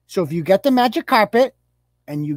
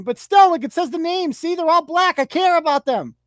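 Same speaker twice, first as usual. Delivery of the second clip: mock outraged mom; 7 semitones higher than usual. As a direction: squeal out hollow concern, much higher. Comedian mocks mom's outrage.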